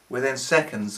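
ordinary delivery, nothing marked

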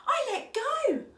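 high, loud exclamation; acted childlike triumph; triumphant cry. A narrator's reading. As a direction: cry out like a thrilled little cub. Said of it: "Otto cried".

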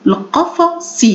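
القفص broken up, ending on short "si"; model pronunciation. Shown as a demonstration. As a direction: set off the last syllable, al-qafa-si, in القفص. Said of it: "ألفظها".

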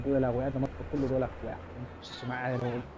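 quick, low Chilean Spanish, tossed off softly; muted, rapid Chilean street accent. Shown as a demonstration. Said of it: "lo digo como un chileno".

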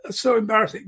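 embarrassed onlooker. Retold or imitated. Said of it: "you'll have people going".

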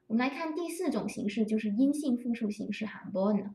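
ordinary talk

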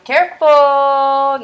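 loud, slow, drawn-out Careful!; warning. Shown as a demonstration. Teacher warns her third graders not to mix up the digits, loudly and slowly. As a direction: stretch it out as a loud warning to the class. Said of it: "teacher warning students".

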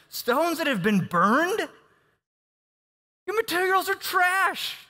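high, slow scornful yell; mocking shout. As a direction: Sanballat yelling scorn at the builders.

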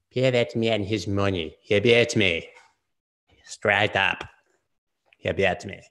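hammed-up Russian accent from Rounders; mock Russian accent. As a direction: imitate a bad movie Russian accent. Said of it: "the worst Russian accent in that movie".

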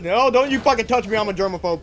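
frantic high yell; scared and angry. Real, not acted. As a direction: yell in panic at the zombie. Panicked at zombie grabbing.

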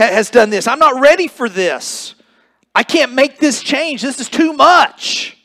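high, loud protesting plea to the Lord; Peter's desperate "not me, Lord"; Peter pleading. Acted. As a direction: beg off the change, voice pushed up and loud. Peter pleading his refusal to God.

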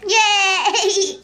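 high laughing cheer; delighted yay. Real, not acted. Gamer grabbing the star, happy laughing cheer.

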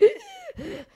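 high strained gasp; wheezing gasp. Retold or imitated. Preacher imitating the sick woman's struggling breath. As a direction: show she can't catch her breath. Woman who could not talk.

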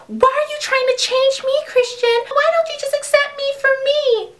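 high, whiny accusation; mock whiny girl. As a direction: whine it like a spoiled girlfriend.

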